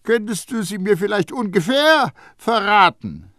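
high, loud, mocking old perfumer; Baldini. A narrator's reading. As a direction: needle the boy with high-pitched scorn. Baldini voiced by narrator.

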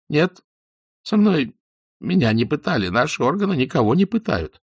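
high, loud frightened denial; frantic denial. Acted. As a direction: deny it too loudly, scared. (испуганно, громко отрицая)

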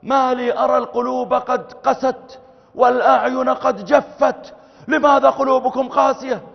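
loud, high reproach to the crowd; scolding preacher. Retold or imitated. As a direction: berate them for hard hearts.

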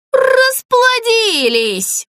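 very high, drawn-out cry; shouting. A narrator's reading. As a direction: stretch it into a high outraged yell. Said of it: "закричал он". (протяжный возмущённый крик)

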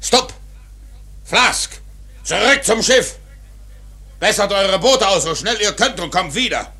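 very high, very loud commands over the sea; captain screaming orders to his crew; shouted orders. Acted. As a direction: roar the orders across the water. Ahab shouting orders to boats.

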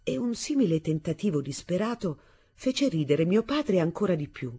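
ordinary delivery, nothing marked

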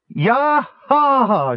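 loud, high, triumphant whoop. A narrator's reading. Kitiara whooping as the obelisk falls, loud and triumphant. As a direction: let out a long victorious yell. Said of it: "a loud, long whoop of triumph".

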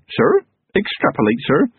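high, startled squeak on sir; baffled recruit repeating the big word; confused young constable. A narrator's reading. Young constable puzzled by his commander's word, high and confused.

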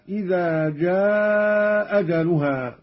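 "جاء أجلها" as "jaaaa ajaluha", long madd; first hamza dropped, long. Shown as a demonstration. Teacher demonstrating madd, "جاء" drawn out into "أجلها". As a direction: stretch "جاء" long, then a single hamza. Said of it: "مع المد والقصر".

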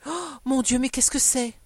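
gasped, high and loud exclamation; startled, anxious outcry; alarmed cry. A narrator's reading. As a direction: burst out in dread of bad news. Wife alarmed by a dispatch.

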